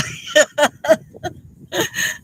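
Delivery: a high, crying laugh; half laugh, half sob, catching herself; laughing through tears. Real, not acted. Widow recalling telling Cindy she made her cry, laughing through tears. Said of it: "I don't mean to cry".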